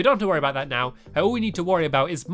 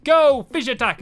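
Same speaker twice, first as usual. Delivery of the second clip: robot battle cry; 8 semitones higher, 7 dB louder than usual. Pretend. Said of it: "it looks like he's going, like".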